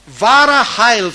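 ordinary delivery

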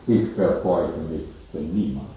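low, calm refusal from Death; Death answering. Acted. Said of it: "Tod antwortet". (tiefe, ruhige Antwort des Todes an den Kaiser)

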